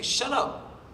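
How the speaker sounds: high, breathy mock dismissal; retelling how he brushed off Arthur, high and mocking; mock shut up. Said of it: "It's like".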